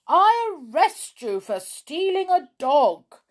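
lightly voiced, loud policeman; solemn policeman. A narrator's reading. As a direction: pronounce it like an official arrest. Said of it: "said the policeman solemnly".

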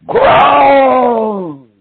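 high, slow, crying shout on groan; stretched, pleading cry from the pulpit; preached cry. Real, not acted. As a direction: wail it out like a preacher at full force.

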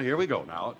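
usual speaking voice

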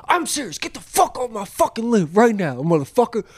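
high furious threat, each word pushed out; mean every word, raging; angry old man. Retold or imitated. Old man yelling again after being ignored. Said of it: "and he's like".

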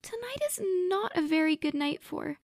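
very high nervous plea; pleading and cut off; Maga, frightened. A narrator's reading. Maga pleads with the spirits, frightened. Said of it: "Maga stammered".

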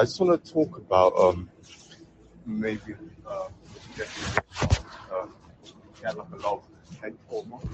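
muffled and far away. Real, not acted. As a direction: voice faint and covered, far from the mic. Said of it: "You sound, like, muffled and far away".

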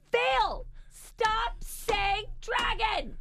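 high, slow, exasperated mock yell; shrieky mom telling-off, no real volume; retold yelling. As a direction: scold word by drawn-out word. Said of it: "yelling at her to stop saying it".

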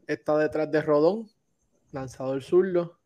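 ordinary talk

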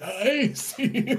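high laughing ghoul voice; Crypt Keeper cackle. Pretend. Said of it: "I sound like the fucking Crypt Keeper".

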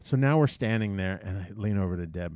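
ordinary talk, nothing marked

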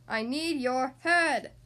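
slow, loud demand; drawn-out storybook yell; mild yell. A narrator's reading. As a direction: stretch it out as a king's order. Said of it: "yelled the king".

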